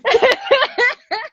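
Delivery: high-pitched giggle; high laugh. Real, not acted. Laughing with her co-host at the joke. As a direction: giggle along.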